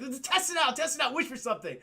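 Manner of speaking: high, hurried excited prompting; mock eager urging. Genie urges Aladdin to test freedom, mock eagerness.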